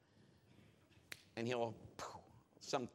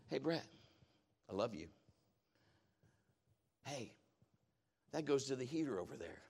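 soft, low voice of God; loving; 4 semitones lower, 8 dB quieter than usual. Retold or imitated. God speaks to preacher about the heater, tender and soft. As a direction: speak gently and quietly, with long pauses. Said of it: "He just lovingly said".

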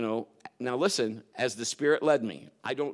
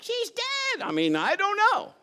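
much higher, alarmed nurse cry; panicked nurse; 4 dB louder than usual. Retold or imitated. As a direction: shriek it like a frightened nurse. Imagined nurse's panicked report.

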